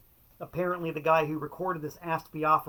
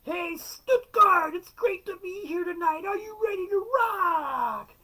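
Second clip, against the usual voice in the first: hammed-up arena rock star, much higher and slow; mock rock frontman; 6 dB louder than usual. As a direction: shout it like a cheesy stadium singer.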